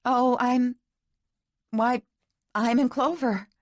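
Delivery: high, halting show of cheer; falter, then insist brightly through near-tears; close to tears. A narrator's reading. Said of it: "She was close to tears".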